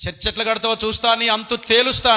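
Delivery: fast, loud, high threatening snarl; angry threat. Retold or imitated. Quarrelling man threatening the pastor, loud and fast. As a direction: menace him like a brawling bully. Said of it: "ఒక అతను గొడవ పెట్టుకొని".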